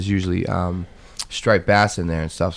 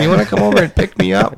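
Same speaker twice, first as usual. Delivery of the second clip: mock drunk plea; 10 semitones higher, 6 dB louder than usual. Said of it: "My legs aren't working".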